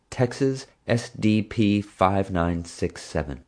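slow dictation, every letter and digit spaced; dictating a plate. Acted. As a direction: read the plate slowly so she can write it.

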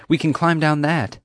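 high, eager voice; urgent exclaiming. A narrator's reading. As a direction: propose an escape in a rush. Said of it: "she exclaimed".

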